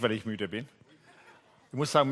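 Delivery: usual speaking voice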